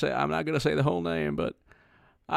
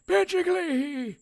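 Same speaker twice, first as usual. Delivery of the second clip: put-on much higher, slow, nasal-free reedy old senator cry; Senator Leahy impression; 4 dB louder, slower than usual. Showing off his Senator Leahy impression, high slow cry. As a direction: call out your own name like an old senator. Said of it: "I did a really good, um, Senator Leahy".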